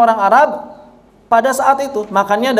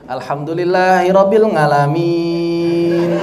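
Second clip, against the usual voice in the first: Javanese Tegal lilt, slow and sung, ngalamin for 'alamin; Tegal-accented recitation; 2 semitones lower, slower than usual. Retold or imitated. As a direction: mimic a Tegal imam chanting ngalamin instead of 'alamin. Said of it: "saya pernah waktu ke Tegal". (tiruan imam Tegal melantunkan ngalamin)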